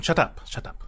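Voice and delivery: hissed hurried whisper. Acted. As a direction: whisper it urgently. Hushed aside.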